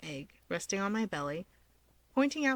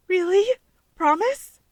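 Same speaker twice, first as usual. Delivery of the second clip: high, loud delighted squeal of hope; excited squeal; 8 semitones higher, 9 dB louder than usual. Acted. Girl squealing at the offered deal, excited and loud. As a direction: squeal it out, barely believing.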